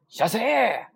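high, loud clipped shop welcome, "shassee"; izakaya staff greeting. Acted. Staff greet you entering the restaurant, high loud call. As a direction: call out a casual slurred irasshaimase. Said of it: "你在料理店儿啊".

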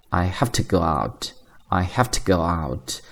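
quiet teaching repeat, "to" unstressed; weak to. Shown as a demonstration. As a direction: model "I have to go out" slowly with a tiny "to". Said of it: "更加自然的一个说法就是".

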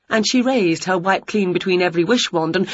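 ordinary delivery, nothing marked

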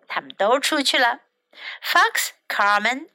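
usual speaking voice

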